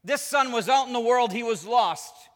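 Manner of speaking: father calling out orders to celebrate; jubilant father. Acted. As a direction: shout the good news to the household. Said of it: "He says".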